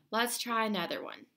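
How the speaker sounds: normal talking voice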